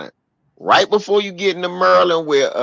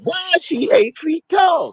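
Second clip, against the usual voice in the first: high-pitched comic exclamation; acted outburst. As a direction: yelp it in mock alarm. Acts out shocked punchline.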